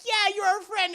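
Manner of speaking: put-on high, eager girlish voice; happy reassurance. Acting girls accepting their new friend, happily eager. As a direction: chirp it high.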